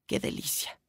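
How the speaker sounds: hushed, voiceless murmur of delight; savouring whisper. Acted. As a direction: whisper it to yourself, relishing the taste. Savouring his favourite milkshake to himself. (susurro de deleite)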